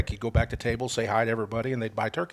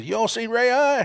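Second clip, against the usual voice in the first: high-pitched retold call; imitated calling out; 11 semitones higher, 4 dB louder than usual. Turkey-feet guy asking at the truck window, calling out. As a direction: imitate a local hollering a question.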